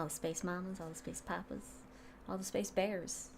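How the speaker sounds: hushed list, barely voiced; whispering. Continues whispered dedication.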